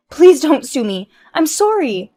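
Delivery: loud, high begging; desperate plea. Acted. Wife begging her friend not to sue, pleading. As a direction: beg for mercy.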